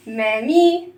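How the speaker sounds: ordinary talk, nothing marked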